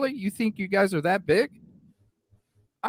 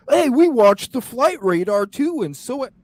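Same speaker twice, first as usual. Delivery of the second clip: higher, loud mock brag from a know-it-all; mocking troll voice; 7 semitones higher, 6 dB louder than usual. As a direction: parody a smug commenter showing off. Mimicking boasting trolls again.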